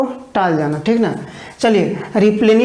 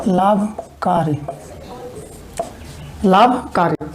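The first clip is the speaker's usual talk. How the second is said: लाभकारी stretched slowly and softer; slow dictation; 3 semitones lower, 5 dB quieter, slower than usual. Shown as a demonstration. Teacher dictating लाभकारी slowly. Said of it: "तो लिखिए".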